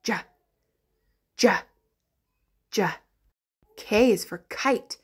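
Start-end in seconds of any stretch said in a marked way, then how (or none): 0.0-2.9 "j" as a short isolated juh; letter J sound. Shown as a demonstration. Teacher answering what J says, spaced short "j" sounds. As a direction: sound out "j" as juh, pausing between each. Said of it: "The J says".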